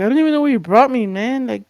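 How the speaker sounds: high, loud, rapid-fire complaint; exasperated protest. Retold or imitated. Stranded younger self complaining to sister, exasperated protest.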